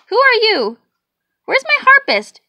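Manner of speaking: high, loud shocked cry; startled. A narrator's reading. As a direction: jump at the stranger. Startled conductor.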